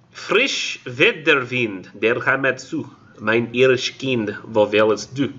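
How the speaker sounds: slow, careful German recital; halting German verse reading. Acted. Lecturer attempting the poem's German for class, halting verse reading. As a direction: sound out the German verse word by word.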